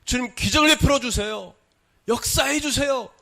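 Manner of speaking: high, loud cry of faith; fervent plea. Acted. Preacher acting out a believer's prayer to the Lord, fervent plea. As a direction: plead at full force, pitched high.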